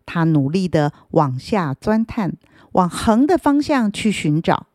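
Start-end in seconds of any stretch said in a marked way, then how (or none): none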